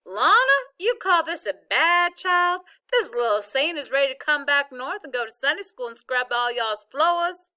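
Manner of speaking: loud, high Southern grandma holler; high-pitched triumphant holler; shouted. A narrator's reading. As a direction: shout it down the phone, drawing out the start. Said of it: "while she shouted".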